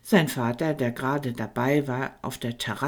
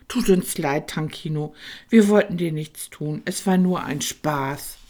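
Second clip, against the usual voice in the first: ordinary delivery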